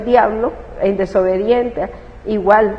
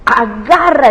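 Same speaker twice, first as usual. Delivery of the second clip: loud, higher plea to heaven; tearful cry; 4 semitones higher, 6 dB louder, slower than usual. Retold or imitated. Mother crying out to God with raised hands. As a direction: offer yourself up, sobbing. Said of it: "todo esto llorando".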